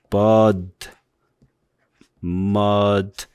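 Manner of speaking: bud and mud said long and easy; relaxed English vowel. Shown as a demonstration. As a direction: stretch bud and mud lazily. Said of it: "이런 식으로 소리 내면 다 됩니다".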